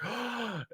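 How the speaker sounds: high, soft gasp; hopeful gasp. Retold or imitated. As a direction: suck in air in mock surprise.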